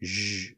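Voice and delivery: Zh as a sustained voiced hush; isolated sound. Shown as a demonstration. Streamer checking a middle consonant with viewers, isolated sound. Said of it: "like that".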